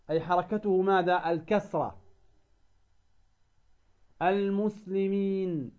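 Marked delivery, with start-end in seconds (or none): 4.2-5.7 المسلمين with the ياء held long and slow; stretched madd. Shown as a demonstration. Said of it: "الياء هنا مكسورة".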